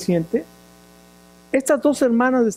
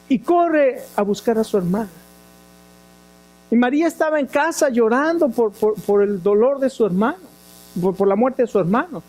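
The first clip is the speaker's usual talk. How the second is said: ordinary talk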